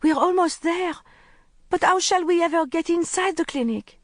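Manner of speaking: put-on high, anguished young boy's voice; a frightened boy begging for a way in. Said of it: "in an anguished voice".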